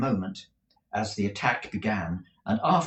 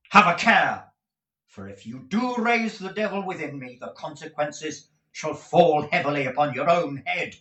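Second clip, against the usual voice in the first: passionate warning; 6 semitones higher, 5 dB louder than usual. Acted. Nicholas warns, flushed with passion.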